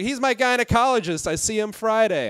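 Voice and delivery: mock-bright lady happily dropping the news; cheery woman. Woman from the audience tells the comic, bright and high.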